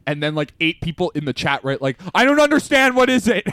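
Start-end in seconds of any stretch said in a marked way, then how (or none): 2.1-3.4 very high, loud mock confusion; baffled clamour. Eight relatives write in the chat, loudly baffled. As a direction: imitate baffled relatives demanding an answer.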